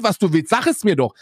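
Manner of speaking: usual speaking voice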